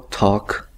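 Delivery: "talk" said slowly as /tok/, no L sound; "talk" without the L, like "tok"; silent L. Shown as a demonstration. Teacher showing students the silent L in "talk". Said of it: "la L no se pronuncia".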